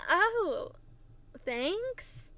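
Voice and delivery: high swooping oh, unsure thanks; taken aback. Retold or imitated. Girl answering an odd compliment, taken aback. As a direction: imitate a puzzled, questioning thanks. Acting the girl's puzzled reply.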